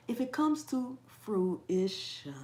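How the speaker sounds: slowing right down to segment "fruition"; slow, careful pronunciation. Shown as a demonstration. Host managing the hard word, slowly and carefully. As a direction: ease into "fruition" one syllable at a time. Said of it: "I have to say it slowly".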